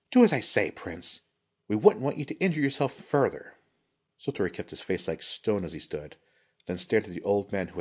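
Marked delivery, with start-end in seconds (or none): 0.1-3.6 menacing. A narrator's reading. Risa threatens the prince.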